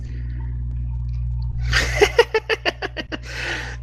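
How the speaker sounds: high wheezing witch cackle; hag cackle. Acted. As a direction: cackle like a gleeful old hag. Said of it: "uh, hag voice".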